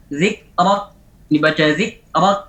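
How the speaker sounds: "Zikrak" with each k stopped dead, no air; no hams. Shown as a demonstration. Teacher shows the missing-breath mistake, unreleased k. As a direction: cut the k's in "Zikrak" without any release of breath. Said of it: "hamasnya tidak ada".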